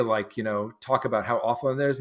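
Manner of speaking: ordinary talk, nothing marked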